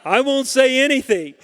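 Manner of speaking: said through real laughter; laughing. Real, not acted. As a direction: laugh the line out.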